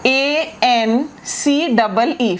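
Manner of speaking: slow letter-by-letter spelling; dictating letters slowly; spelled out. Shown as a demonstration. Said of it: "spelling out fiancee".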